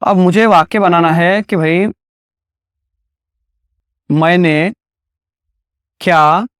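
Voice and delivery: usual speaking voice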